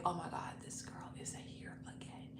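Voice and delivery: whispered "oh my God" to herself; very quiet, under the breath; hushed dread. Retold or imitated. Woman privately dreading an angry girlfriend, hushed.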